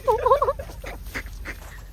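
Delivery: high-pitched amused giggle; high laugh. Real, not acted. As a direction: break into a long, high laugh. Laughing while teasing the child.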